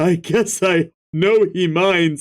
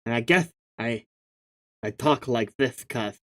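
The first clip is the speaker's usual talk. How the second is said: quiet low mumble; 6 semitones lower, 8 dB quieter than usual. Acted. Mumbling character line, quiet.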